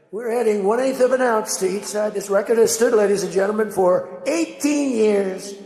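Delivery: hammed-up stadium announcement; mock announcer. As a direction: boom it out like an arena announcer. Mimics the meet announcer.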